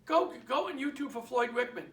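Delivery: ordinary talk, nothing marked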